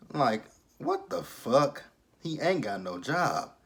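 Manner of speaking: ordinary delivery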